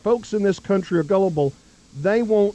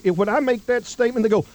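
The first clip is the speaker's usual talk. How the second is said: normal talking voice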